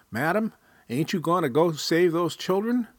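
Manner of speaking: high, urgent voice of a stranger; urgent passing woman. Acted. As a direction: urge her to save the children. Passing woman urges Julia.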